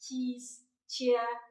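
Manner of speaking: சீஸ், சியர் said slowly, ch drawn out; sounding out ch. Shown as a demonstration. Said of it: "இச் sound". (மெதுவாக ச் ஒலியுடன் உச்சரித்தல்)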